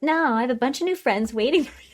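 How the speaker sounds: high, put-on cheerful brush-off; imitated upbeat refusal. Acts her breezy refusal to sit.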